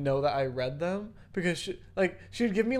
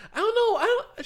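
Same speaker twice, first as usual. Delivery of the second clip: much higher, louder put-on teacher voice; imitated teacher; 8 dB louder, faster than usual. Student mimicking the English teacher musing in class, high mock voice.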